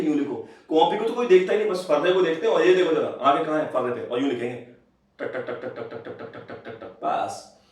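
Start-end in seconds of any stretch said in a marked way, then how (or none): 5.2-7.0 rhythmic tapping that fades to a whisper; tapping sound. Retold or imitated. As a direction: mimic a pen scribbling, dying away. Imitating fast copying.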